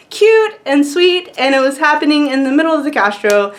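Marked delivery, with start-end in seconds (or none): none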